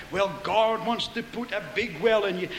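ordinary talk, nothing marked

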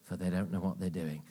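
hushed prayer after a defiant vow; soft prayer. Shown as a demonstration. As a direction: drop into a quiet prayer. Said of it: "But you gotta get".

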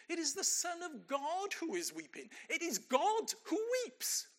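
building preaching climax. Real, not acted. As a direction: rise to a ringing peak of awe. Preaching climax on weeping God.

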